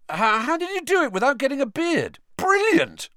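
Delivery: high, excited roar; loud, high-pitched cheer of admiration. A narrator's reading. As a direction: shout in amazed delight, building to the last word. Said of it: "roared George".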